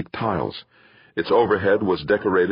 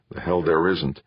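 usual speaking voice

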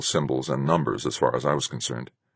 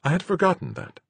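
female character voice. A narrator's reading. Narrator voices Marguerite.

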